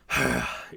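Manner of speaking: short weary sigh; exasperated sigh. Acted. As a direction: let out an annoyed sigh. Annoyed villain sighs before explaining.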